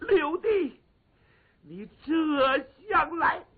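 high, loud, slow joyful call; a rescued king calling out in delight; overjoyed greeting. Acted. Rescued king joyfully calling to his brother. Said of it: "朱元璋乐得俩手都拍不到一块儿了".

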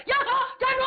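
high yelling call to stop; shouted halt. Acted. Pursuer yelling at fleeing Hu Dahai to halt, loud high shout. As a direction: bark after the fleeing rider.

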